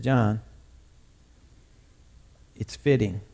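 low, soft reply after a pause; gentle Jesus. Retold or imitated. Jesus talking John into it, hushed and low. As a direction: answer the protest very quietly.

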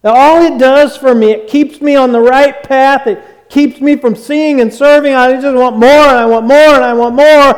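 urgent, hungry begging prayer. Acted. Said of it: "a cry and a plea for more".

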